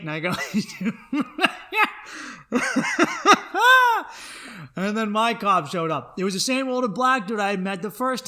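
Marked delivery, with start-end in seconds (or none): 0.4-4.7 loud, high-pitched cackling laugh; long high laugh. Real, not acted. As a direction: laugh helplessly, rising higher and higher. Loud laugh at his own story.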